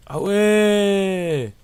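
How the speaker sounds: loud, high, long-held "ouais"; big stretched cry of discovery; astonished exclamation. Real, not acted. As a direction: call it out in drawn-out amazement. Discovers more floors in the game.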